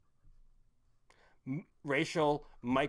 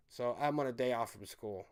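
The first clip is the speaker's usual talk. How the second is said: ordinary talk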